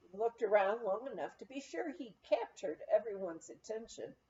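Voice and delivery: usual speaking voice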